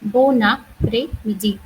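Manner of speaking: "bon après-midi" slowly, bon run into après as one word; slow linked repeat. Shown as a demonstration. Teacher repeating "bon après-midi" slowly for students to copy. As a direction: spell it out by ear, linking bon-après. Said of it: "I repeat again".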